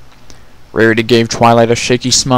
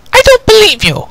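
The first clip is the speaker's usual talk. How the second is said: loud, high angry snap; snapped; 5 dB louder than usual. Acted. Twilight snaps at the impostor in anger. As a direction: snap it back, much higher and louder. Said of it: "Twilight snapped".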